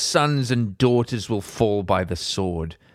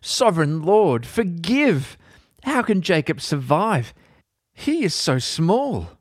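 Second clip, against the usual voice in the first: higher, urgent cry for mercy; pleading prophet; 7 semitones higher than usual. Acted. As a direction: beg God to spare his people. Amos pleading with God.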